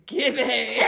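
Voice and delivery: loud, drawn-out comic growl of a name; laughing imitation of a menacing kid; mock menacing. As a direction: shout your own name like a threatening catchphrase. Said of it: "All menacingly".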